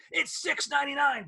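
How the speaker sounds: high, punchy announcer hype; obnoxious ad voice. Retold or imitated. Radio host mimics the record-store ad announcer, obnoxious. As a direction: bark the price like a pushy ad man. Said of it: "this obnoxious voice goes".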